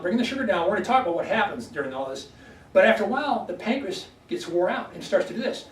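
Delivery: normal talking voice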